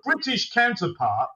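usual speaking voice

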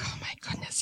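hushed, whispered aside; under-the-breath "here it comes"; retold dread. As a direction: whisper it to yourself in dismay. Retold dread as patient erupts.

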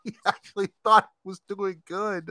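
giggling repeat of the line; laughing. Real, not acted. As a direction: say it again, laughing harder.

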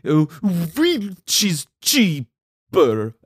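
slow, drawn-out fake Eastern European accent; imitating a fake Eastern European accent; mock Eastern European accent. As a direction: stretch it out in a put-on vague Eastern European accent. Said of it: "And she's like".

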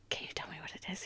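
hushed conspiratorial whisper. Retold or imitated. To her brother during a hug, whispering secretly. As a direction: whisper it so nobody else hears.

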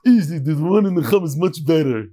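lightly put-on voice of a friend butting in; Nachman Seltzer voice. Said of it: "says Nachman Seltzer".

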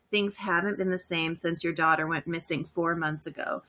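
low, steady dramatic narration; game master scene setting. Acted. The game master sets the scene for the player, dramatic. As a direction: set the scene like a crime drama.

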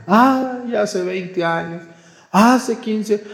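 high, drawn-out ay, hace veinte años; mock lament. Priest imitating himself dwelling on old hurts, high and drawn out. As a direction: sigh it out like an old wound.

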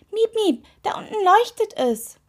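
high, loud excited cry. A narrator's reading. Toe spotting a glow below, calling the witch excitedly. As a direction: alert her urgently in a high toe voice. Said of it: "sah aufgeregt aus".

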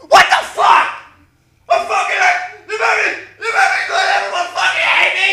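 acted anime rage, voice cracking high and loud; furious screaming as Naruto. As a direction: pitch shooting way up into a raw, enraged scream. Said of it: "Sorry. That's what he wanted to do".

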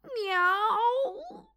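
high, long rising cry of “Miau”; yowling meow. Acted. Bill yowls as he falls in the puddle, high cry. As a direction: yowl in dismay as you land in mud. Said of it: "krzyknął Bill".

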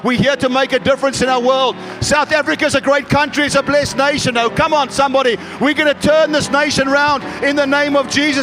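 rally the church behind the nation; fired-up preacher calling the congregation to action; preaching at full force. Acted.

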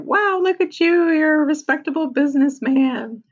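high, bright-eyed encouragement with a pitch jump; chirpy upbeat girlfriend. Retold or imitated. Claire gushing over Charlie in his new suit, high and eager. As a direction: gush like a relentlessly positive girlfriend.

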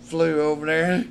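ordinary talk, nothing marked

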